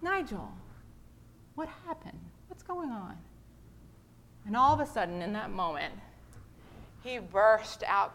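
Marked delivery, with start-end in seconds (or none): 0.0-3.2 soft, high, tender question; gentle concern. Retold or imitated. Pastor gently asks Nigel, soft concern. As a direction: coax him to open up. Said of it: "I'm like".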